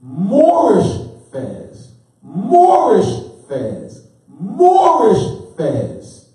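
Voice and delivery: very slow and loud, each "Moorish fez" stretched; the teacher drilling "Moorish fez" with slow, heavy weight; emphasized, drawn out. Shown as a demonstration. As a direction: drag out "Moorish fez" word by word. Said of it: "It's very important that we emphasize".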